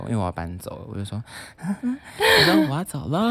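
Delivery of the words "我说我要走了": gentle goodbye. Retold or imitated. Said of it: "我就，我就变很温柔".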